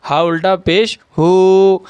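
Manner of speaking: slow spelling-out, long held "ہو"; drawn-out vowel. Shown as a demonstration. Teacher spelling out a Quran word for learners, "ہو" drawn out. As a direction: stretch the final "ہو" by one beat. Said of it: "الٹا پیش کو بھی ایک الف کے برابر کھینچ کر پڑھیں گے".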